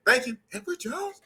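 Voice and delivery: rising question tune on "Thank you, Edward Jones?". Retold or imitated. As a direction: end "Edward Jones?" high and rising as if asking. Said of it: "You say".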